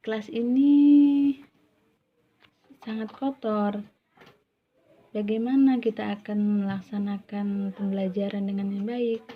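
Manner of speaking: a teacher scolding a dirty class; teacher to students about the dirty classroom, lightly stern. Acted. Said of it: "dia akan mengatakan seperti ini".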